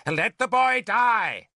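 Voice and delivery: high, drawn-out shout. A narrator's reading. Merlin shouts from the ladder. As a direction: shout it slowly from a distance. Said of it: "Merlin shouted from the ladder".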